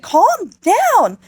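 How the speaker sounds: very high squawked order; exasperated mock yell. Duchess to hysterical Beatrice, high mock yell. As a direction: shriek it back at her, hammed up. Acted shout at a hysterical friend.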